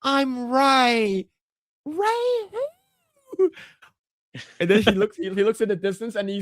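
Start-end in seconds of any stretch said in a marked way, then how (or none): 0.0-3.5 high voiced reply; lightly mocking heroine voice; mock Rey. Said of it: "he's like".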